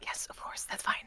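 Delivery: hushed in-character whisper; very soft, breath-only reply. Acted. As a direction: whisper it with no voice at all. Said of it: "this whispering thing is very weird".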